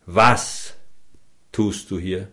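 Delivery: „Was“ set apart, then a pause before „tust du hier?“; first word weighted: „Was“; stress on WAS. Shown as a demonstration. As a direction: lean on „Was“ alone, let the rest fall quietly.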